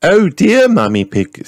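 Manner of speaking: high, loud sighing rabbit lady; Miss Rabbit sighing. A narrator's reading. Miss Rabbit sighs to Mummy Pig, high and loud. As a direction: sigh it out as the fussy rabbit. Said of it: "sighed Miss Rabbit".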